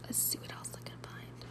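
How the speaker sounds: very soft whispered aside. Real, not acted. Host looking for the next quote, whispered aside. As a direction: mutter it to yourself. Muttering while searching.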